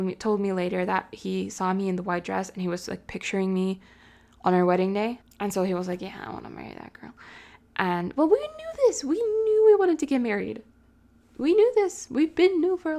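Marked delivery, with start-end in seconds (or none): 6.0-7.1 hushed low imitation of him; breathy murmur as the boyfriend; whispered quote. Retelling his words in a hush.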